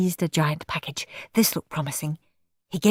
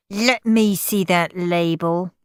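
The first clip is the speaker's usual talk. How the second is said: ordinary delivery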